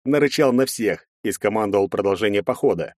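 ordinary delivery, nothing marked

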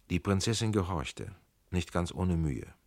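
plain reading voice

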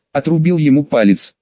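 ordinary delivery

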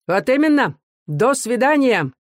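smug officer stretching the goodbye; smirking farewell. A narrator's reading. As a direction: echo the farewell with a sneer. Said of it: "усмехаясь, повторил офицер".

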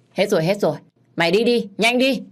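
impatient, building in pitch; brusque shooing away. A narrator's reading. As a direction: hurry an unwanted customer off. Shopkeeper shooing the customer again.

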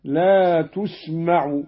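تسمع recited "tusmaʕu" with a u on the ta; damma on ta. Shown as a demonstration. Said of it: "قرأ قالون بضم التاء".

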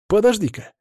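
high, sudden alarm; startled gasp. A narrator's reading. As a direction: catch them short in surprise. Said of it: "ахнул Артур".

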